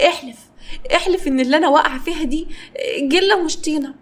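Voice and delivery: disbelieving, rising to a cry for mommy; shocked girl spiralling into panic. A narrator's reading. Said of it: "كارمن بصت له بصدمة".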